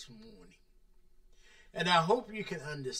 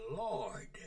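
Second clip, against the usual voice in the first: hushed, reverent Lord; whispered Lord; 6 dB quieter than usual. Shown as a demonstration. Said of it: "Master, Lord".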